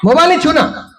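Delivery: high-pitched imitation of a scolding mother; mock scolding. Mother forbidding her child the phone, high mock scolding. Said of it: "घर में डांटती है".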